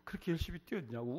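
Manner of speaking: a grieving father keening over his sick son; wailing father. Retold or imitated. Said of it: "울기만 울어요".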